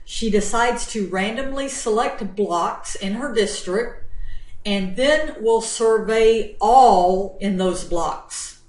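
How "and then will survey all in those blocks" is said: ALL louder and drawn out; slow phrase with a heavy emphasis on all; stress on all. Shown as a demonstration. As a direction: lean hard and long on the word all. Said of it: "Notice my emphasis on the word all".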